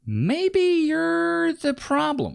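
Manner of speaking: high, slow, drawn-out mock shout. Host calls out the creator's lack of self-awareness, drawn-out high mock shout. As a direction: stretch it out way up high like a gotcha. Mocking host's punchline.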